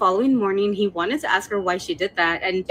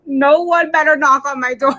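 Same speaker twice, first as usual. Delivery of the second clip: louder, higher, half-laughing insistence; mock alarmed warning; 5 semitones higher, 7 dB louder than usual. Storyteller warning imagined restroom visitors off her door, loud mock alarm. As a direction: raise your voice in joking panic.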